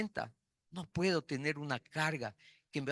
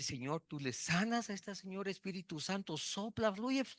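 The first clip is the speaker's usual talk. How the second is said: soft prayer; 4 semitones higher, 4 dB quieter than usual. Retold or imitated. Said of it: "oré y dije".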